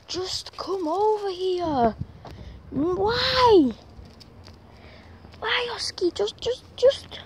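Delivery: high, strained, pleading whine; whining at the stubborn dog; exasperated whine. Real, not acted.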